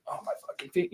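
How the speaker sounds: whispered, strained and hurting; pained whisper. Retold or imitated. Retelling a horror movie to a friend, the victim's whispered pain. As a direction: whisper it like her feet are giving out. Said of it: "and then she's like".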